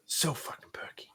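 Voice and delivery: whispered sarcastic aside. Real, not acted. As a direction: hiss it under your breath, mocking. Sarcastic aside about the accent.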